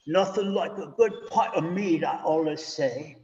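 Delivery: put-on a light Geordie accent, playful. Minister joking about mead in a mock Geordie accent. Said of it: "It's my best Geordie accent".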